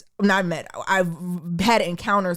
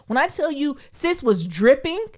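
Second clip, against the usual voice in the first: ordinary talk, nothing marked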